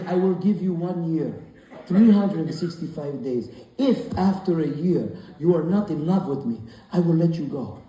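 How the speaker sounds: put-on measured Italian-accented mafia suitor; smooth Italian accent. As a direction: state the terms slowly in an Italian accent. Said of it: "What kind of Italian accent is that?".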